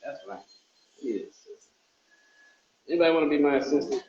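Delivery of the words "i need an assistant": soft breathy whisper of the same plea. Pretend. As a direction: whisper it like a secret wish.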